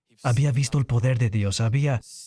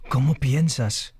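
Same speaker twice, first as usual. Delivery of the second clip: normal talking voice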